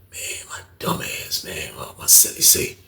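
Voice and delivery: hushed gossip between inmates; confiding whisper. Retold or imitated. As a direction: whisper it like a secret. Old head confiding gossip.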